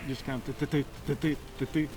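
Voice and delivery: quiet rhythmic th-th-th tongue flutter; tongue chatter. Shown as a demonstration. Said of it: "by my tongue".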